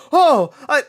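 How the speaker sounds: put-on a kid blindsided by the plot twist, near screaming; high, loud, betrayed disbelief; shocked kid outrage. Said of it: "I was like".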